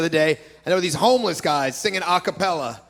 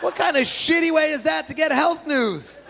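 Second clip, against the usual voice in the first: ordinary talk, nothing marked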